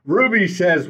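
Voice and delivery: usual speaking voice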